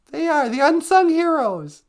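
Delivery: very high, loud squeaky declaration. Acted. The sidekick squeaks praise for snowplow drivers to the host. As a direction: squeak it out proudly.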